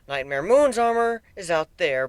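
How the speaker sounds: ordinary delivery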